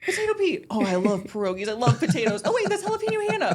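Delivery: high, excited retelling of a cartoon character's lines; hammed-up enthusiasm without attempting the real voice; Patrick Star. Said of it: "I cannot do the Patrick Star voice".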